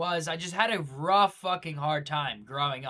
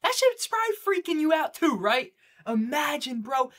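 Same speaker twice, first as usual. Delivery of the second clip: much higher, louder freaked-out voice; 12 semitones higher, 8 dB louder than usual. Acted. Speaker imagining a dull routine to viewers, freaked out. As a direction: shriek it like the thought scares you. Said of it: "I'm not even trying to sound weird".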